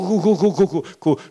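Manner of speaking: high wailing imitation of a crying kid; mock child crying. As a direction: wail it like a sulking child. (模仿小孩哭闹)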